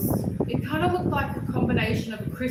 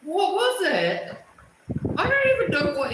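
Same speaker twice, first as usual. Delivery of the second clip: exclaiming; 7 semitones higher, 6 dB louder than usual. Real, not acted. Exclaiming about strange creature.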